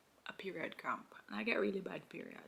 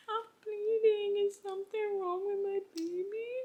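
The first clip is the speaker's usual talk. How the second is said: panicked; 13 semitones higher, 8 dB louder than usual. Retold or imitated. Mother calling the nurse, high panicked plea. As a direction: plead in panic for the baby. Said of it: "I called her, I said".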